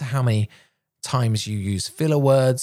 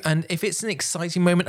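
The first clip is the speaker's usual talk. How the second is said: excited; 6 semitones higher, faster than usual. Shown as a demonstration. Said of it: "when I say exciting".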